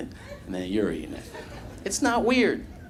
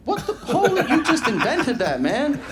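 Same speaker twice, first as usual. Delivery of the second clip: much higher, gushing mock amazement with laughter; amazed engineer; 11 semitones higher than usual. Imagined software engineer gushing over the cousin's idea, much higher. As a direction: gush like a dazzled fan. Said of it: "they would be like".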